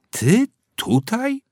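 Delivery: high, drawn-out surprised call; zaskoczone zawołanie. A narrator's reading. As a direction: call out in shock at seeing him. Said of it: "zawołał". (zaskoczony okrzyk)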